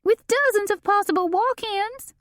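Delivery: shrill it in a panic; high, piercing, frantic voice. A narrator's reading. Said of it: "Delane shrilled".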